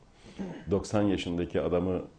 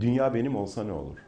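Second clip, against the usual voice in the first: ordinary talk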